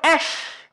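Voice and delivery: "Esh" breathed voiceless, ending in a hushing sh; voiceless "Esh" showing the sh; sh sound demo. Shown as a demonstration. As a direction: hiss out "Esh" with the sh. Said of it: "Caranya adalah bilang".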